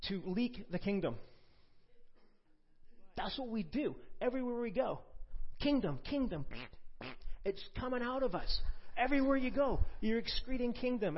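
high, loud, building preacher's fervour; preach. Acted. Preacher urging the congregation, shouting fervently. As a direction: stir the crowd, kingdom, kingdom.

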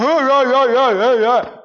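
loud, high crazed cry; a wild, loud madman; crazed demoniac. Retold or imitated. Demoniac voiced on the shore.

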